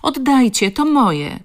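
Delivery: high, loud helpless cry; tearful shout. A narrator's reading. Crying girl to the boys who took her notebook, tearful shout. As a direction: plead loudly, near tears. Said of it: "krzyczała, zalewając się łzami z bezsilności".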